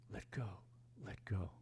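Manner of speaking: ordinary delivery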